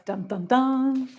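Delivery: slow sung dun dun dun; dramatic sting. Pretend. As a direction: hum a suspense sting slowly. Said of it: "there's where we need our sound effects".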